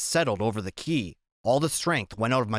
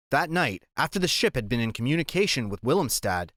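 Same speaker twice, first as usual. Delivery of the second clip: usual speaking voice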